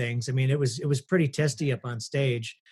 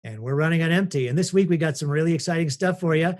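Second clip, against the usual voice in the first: ordinary talk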